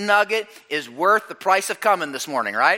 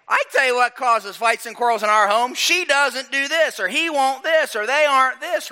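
high, whiny complaint; imitating complaining spouse; 6 semitones higher than usual. People at home blaming others for quarrels, high whiny complaint. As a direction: gripe like a fed-up spouse. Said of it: "we'd say".

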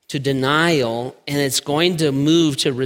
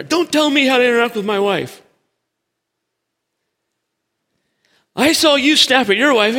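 high, louder snapping retort; defensive husband; 9 semitones higher, 4 dB louder, faster than usual. Acted. Husband snapping at his friend, high and louder. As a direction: bite back at the friend's advice.

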